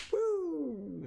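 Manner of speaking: long, very high "woo" sliding down at the end; high-pitched whooshing woo; high imitated whoop. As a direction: mimic someone zooming away. Mimics her speeding off.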